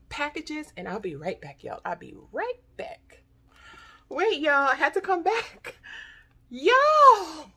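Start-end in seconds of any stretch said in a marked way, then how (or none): 4.1-7.5 loud, high excited call-out; shouting with real joy; thrilled. Real, not acted. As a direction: burst back in to tell everyone. Rushes back thrilled by package.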